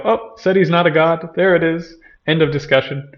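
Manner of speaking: quick verdict in a lifted mimicking voice; dismissive audience. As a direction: act out a listener happily closing the case. Mimics dismissive secular Western audience.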